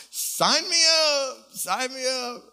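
high, loud and drawn out, slow; eager. Acted. Preacher to congregation, cheering loudly and slowly. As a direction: sign up with a big happy cry. Eager exclamation mid-sermon.

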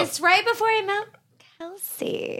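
Camille impression with creaky fry; vocal fry. Shown as a demonstration. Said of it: "I have vocal fry".

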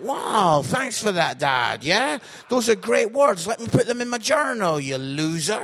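very loud, mock-grateful rant; sarcastic outburst. Comedian answering his dad backstage, sarcastic outburst. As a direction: blast Dad with loud fake thanks.

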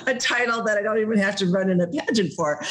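high, laughing self-mockery; self-mocking laugh. Calling herself a loser over the postcard, laughing. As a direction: scoff at yourself through a laugh. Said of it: "I'm like".

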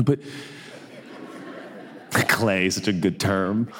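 ordinary talk, nothing marked

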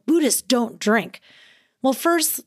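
usual speaking voice

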